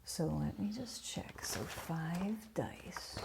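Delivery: whispered. Real, not acted. Checking rules, muttering to self.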